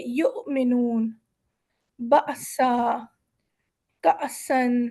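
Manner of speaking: یؤمنون slow, with a sharp glottal catch on the hamza. Shown as a demonstration. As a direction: clip یؤمنون at the hamza, said slowly. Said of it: "ایک جھٹکا دے کے گزر جائیں گے".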